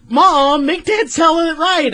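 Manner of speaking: put-on whiny kid daughter. A fan voices Lisa begging her mom, high and pleading. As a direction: plead like a little girl. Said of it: "you have Lisa go".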